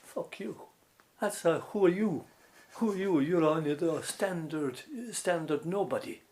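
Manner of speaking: loud, high, cutting comeback; angry retort. Retold or imitated. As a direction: tell him off hotly. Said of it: "And I'd s-, I'd say".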